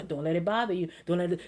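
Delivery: softly, talking herself down; quiet self-talk. Shown as a demonstration. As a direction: murmur it to yourself to stay calm. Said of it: "These are things I say to my".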